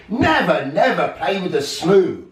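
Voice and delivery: hammed-up scolding with a laugh; angry grandfather. A narrator's reading. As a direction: grandfather shaking his fist, mildly angry. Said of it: "as he angrily said".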